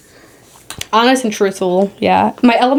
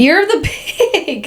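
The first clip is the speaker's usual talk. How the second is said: high, drawn-out laughing reaction; amused disbelief; 7 semitones higher, slower than usual. Real, not acted. Friend discovering her zodiac animal, laughing in high-pitched disbelief. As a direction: draw it out high, breaking into laughter.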